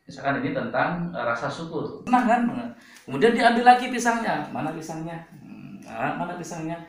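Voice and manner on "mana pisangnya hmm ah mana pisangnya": softly acted sad child missing a banana; a touch of sadness, much softer. Younger sibling asks after the taken banana, mildly sad. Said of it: "Dia bersedih".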